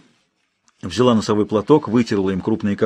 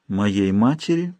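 тихо и медленно; протянуть, вдумываясь; 2 semitones lower, 3 dB quieter, slower than usual. Acted. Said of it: "медленно произнесла она". (медленно, задумчиво)

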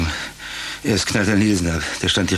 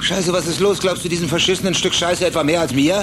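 indignant outburst; 8 semitones higher than usual. Acted. Accused, furious at partner.

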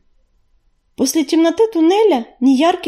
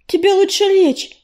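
higher, fussing concern; a touch of alarm, pitched up; mildly alarmed; 7 semitones higher than usual. A narrator's reading. Healer to the injured leader, mildly alarmed. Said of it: "всполошилась целительница".

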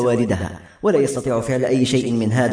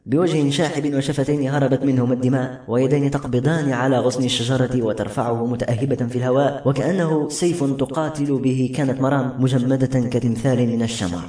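ordinary delivery

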